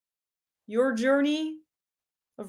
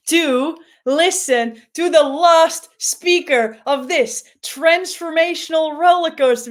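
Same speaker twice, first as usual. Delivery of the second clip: emcee hype; 4 semitones higher, 8 dB louder than usual. Acted. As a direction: pump up the room like a host. Host hyping the crowd.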